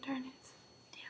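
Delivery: annoyed whisper; whispered frustration. Real, not acted. Hiccup returns, whispered frustration.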